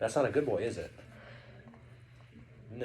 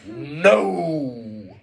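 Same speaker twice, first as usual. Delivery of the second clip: loud, drawn-out bellow; Triton; 6 dB louder than usual. A narrator's reading. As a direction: bellow it long like a furious sea king. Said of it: "that's how Triton talks".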